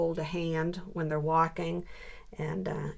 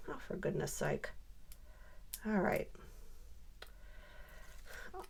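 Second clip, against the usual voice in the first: whisper; 12 dB quieter than usual. Real, not acted. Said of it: "whispered self-talk".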